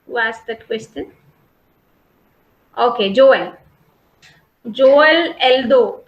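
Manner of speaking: usual speaking voice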